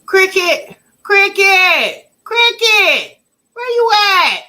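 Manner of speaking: loud mock calling for a friend. Streamer calling out for a missing YouTuber by name. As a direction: holler the name across the room.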